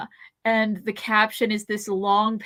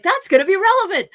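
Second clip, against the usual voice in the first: higher, louder, fast knowing call; knowing exclamation; 9 semitones higher, 8 dB louder, faster than usual. Retold or imitated. Viewer predicting the pineapple twist, loud quick knowing call. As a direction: call it like you spotted the clue. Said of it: "I was like".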